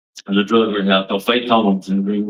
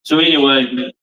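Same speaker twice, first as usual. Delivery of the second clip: ordinary talk, nothing marked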